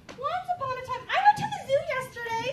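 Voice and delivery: put-on high, loud, rushed kid interruption; hammed-up kid cutting into the story; eager kid voice. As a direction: blurt it out like an excited child. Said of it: "interrupting kid".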